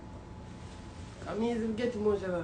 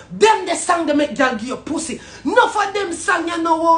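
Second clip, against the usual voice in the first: loud, higher shouting rant; furious rant; 7 semitones higher, 8 dB louder than usual. Real, not acted. Man raging at artists about girl songs, loud rant. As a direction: hammer every line at the artists.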